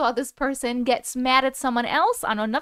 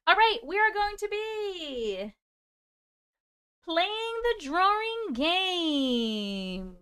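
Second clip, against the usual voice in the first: stretched slow announcer voice; slow drawn-out game announcement; slow announcing; 6 semitones higher, 4 dB quieter, much slower than usual. Real, not acted. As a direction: draw it out like a host.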